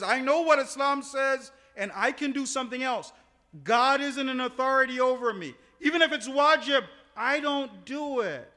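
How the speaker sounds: high, forceful declaration of refusal; loud defiance. Acted. Spouse who picks and chooses rejecting authority, loud defiance.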